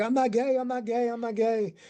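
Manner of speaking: a high, insistent protest; retelling Chad's high-pitched denial. Retold or imitated. Chad denies it, retold.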